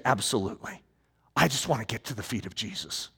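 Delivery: ordinary delivery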